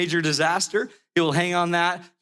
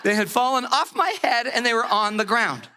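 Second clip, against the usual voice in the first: ordinary delivery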